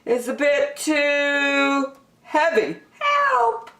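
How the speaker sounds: high, slow, then a yelp for help; a small bear straining, then crying out; strained plea rising to a squeak; strained Douglas. Acted. Douglas struggling under weight.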